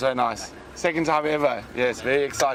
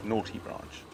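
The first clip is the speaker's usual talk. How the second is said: mock scolding under breath; 3 semitones lower, 10 dB quieter than usual. Real, not acted. Branch stuck under the car.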